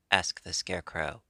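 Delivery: ordinary delivery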